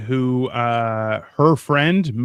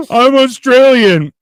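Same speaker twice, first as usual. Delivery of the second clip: high, loud, slow mock claim; high slow mocking impression of a viewer; mocking Bodhi voice; 9 semitones higher, 9 dB louder, slower than usual. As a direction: parody the liar proudly insisting. Imitating Bodhi.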